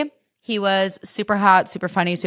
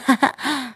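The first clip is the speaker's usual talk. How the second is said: giggle in character; 6 semitones higher than usual. Retold or imitated. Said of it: "That's my Huda".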